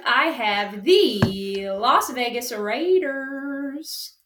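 very slow, stretched words; drawn-out reveal. Real, not acted. Host reveals the randomized team to co-host, drawn out.

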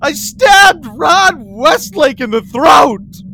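high, loud, slow sobbing shout; crying confession. Acted. Boy confessing the stabbing to the creature, sobbing shout. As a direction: blurt it out through tears.

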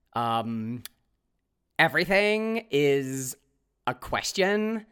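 hesitant upspeak demo. Shown as a demonstration. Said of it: "speaking like they're searching for their next word".

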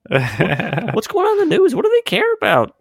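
very high nasal voice; nasally voice. Pretend. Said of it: "giving them this nasally voice".

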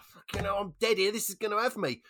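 high-pitched panicked postman; mock panic. Joke's postman panics at the dog.

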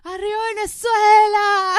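loud, high Venezuelan cheer; shouted cheer for the home country; patriotic cheer. Real, not acted. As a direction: belt it out like a proud Venezuelan fan. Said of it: "se me sale lo venezolana".

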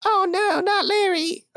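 high voice, alarmed at the name; dismayed woman. Acted. Woman hears her ex stole the cat, high and dismayed.

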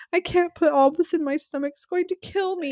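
high-pitched imitated wail; mock bawling. Retelling Victoria wailing over her soup, mock bawling. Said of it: "she's literally bawling and crying and screaming".

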